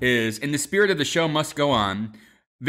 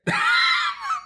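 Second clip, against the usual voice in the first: shouted laugh. Real, not acted. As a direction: let out a big yelping laugh. Big burst of laughter.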